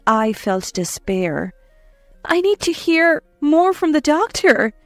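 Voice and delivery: usual speaking voice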